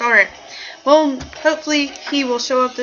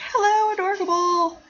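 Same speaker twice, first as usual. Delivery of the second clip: singsong greeting; 10 semitones higher than usual. Retold or imitated. Host re-enacting a greeting to a viewer, high singsong. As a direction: imitate yourself greeting someone in a high singsong.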